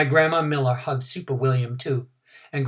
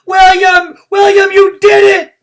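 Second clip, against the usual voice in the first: delighted shout, much higher and louder; joyful hollering; 15 dB louder, slower than usual. A narrator's reading. Room nine hugging their hero, joyful hollering. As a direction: pile on him cheering. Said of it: "we hollered real joyful".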